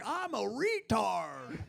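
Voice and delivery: slow, stretched-out mock dimwit voice; mock-dopey self-parody. Comic mocking himself over butchered words, mock-dopey. Mocks himself in an acted quote.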